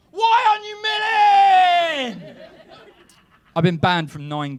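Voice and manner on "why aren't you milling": very high, slow, laughing near-scream; hysterical shout. Retold or imitated. Imagined bread snob demanding answers in a cafe, screaming. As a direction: lose it completely at the cafe owner, wailing. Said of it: "you'll be going".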